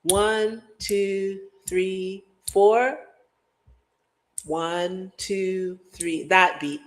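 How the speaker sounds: a steady spoken one two three four count; even beat count. Shown as a demonstration. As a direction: count it off evenly like a metronome. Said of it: "this beat".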